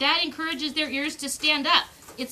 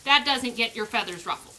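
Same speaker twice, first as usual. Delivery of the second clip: ordinary delivery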